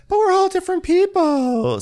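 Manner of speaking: high mock Brandy voice; Brandy impression. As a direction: squeak it out like a flustered Brandy.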